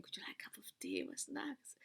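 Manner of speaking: sheepish soft offer. Retold or imitated. After calming down, offering her partner tea, a sheepish whisper. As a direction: coax him gently to make amends.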